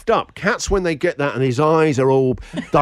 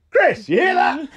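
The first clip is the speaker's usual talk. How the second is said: alarmed call; 12 semitones higher than usual. Retold or imitated. Wife calling out about the crash.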